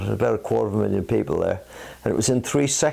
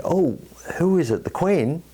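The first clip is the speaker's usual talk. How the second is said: pitched up, eager guessing voice; higher playful question, joke build-up; higher mock guessing; 8 semitones higher than usual. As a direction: ask it bright and high, playing along. Joke dialogue, rising excitement.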